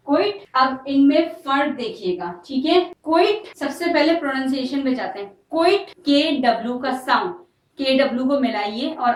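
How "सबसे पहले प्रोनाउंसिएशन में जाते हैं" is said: usual speaking voice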